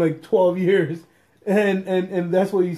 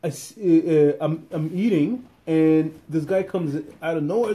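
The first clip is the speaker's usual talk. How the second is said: ordinary delivery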